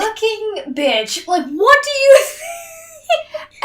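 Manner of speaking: slow, high, laughing shout; S1 rants about Bella asking to be bitten, laughing shout; exasperated outburst. Real, not acted. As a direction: yell it at Bella in disbelief. Outraged at Bella's request.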